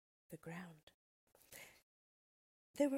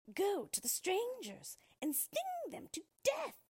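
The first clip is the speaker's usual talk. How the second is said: much higher witch voice with a different timbre; crying witch; 3 dB louder than usual. A narrator's reading. Wicked Witch sending bees, high venomous cry. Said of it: "she cried".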